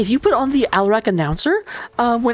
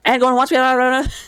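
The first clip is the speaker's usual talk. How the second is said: auctioneer; 2 semitones higher than usual. Acted. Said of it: "I sound like an auctioneer".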